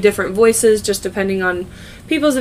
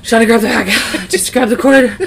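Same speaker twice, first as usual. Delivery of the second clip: mock panic; 2 semitones higher, 5 dB louder than usual. Imagined labor panic orders.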